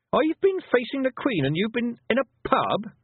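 put-on high, outraged girl's voice; incredulous rebuke climbing high; indignant. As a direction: scold them in disbelief. Indignant girl scolds the Feegles.

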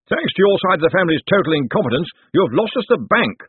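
high-pitched family accusation; accusing. A narrator's reading. Relative blames the other branch for losing the bank, accusing.

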